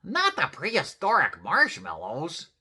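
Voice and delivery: high, loud mock dismay; shocked exclamation. A narrator's reading. Skipito reacts to toasted marshmallows, exclaiming. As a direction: exclaim it in alarm. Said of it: "exclaimed Skipito".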